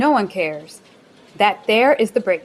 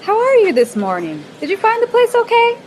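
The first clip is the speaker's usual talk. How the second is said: very high, sing-song question, a bit louder; chirpy question; 12 semitones higher, 3 dB louder than usual. Acted. Chirpy small talk while walking.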